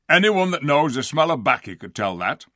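loud, rustic dwarf scoff; dismissive dwarf. A narrator's reading. Diggle dismissing Lucy's proof, scornful. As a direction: brush off the proof rudely.

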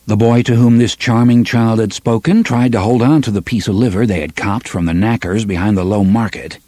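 ordinary delivery

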